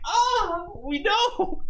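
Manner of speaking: very high-pitched exaggerated groan; mock exasperation. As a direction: whine it in hammed-up exasperation. Mock exasperation at dad's habit.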